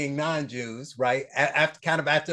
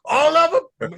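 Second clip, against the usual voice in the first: high, loud retold disbelief; mock incredulous; 12 semitones higher, 8 dB louder than usual. Host replays his comeback in an old debate, incredulous. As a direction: leap up in pitch on an astonished question.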